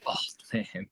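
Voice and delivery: hushed, whispered echo of a name. Pretend. Co-host echoing the superhero name, whispered. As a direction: whisper it low under your breath.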